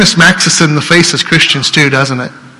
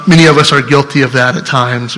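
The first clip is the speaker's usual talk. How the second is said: normal talking voice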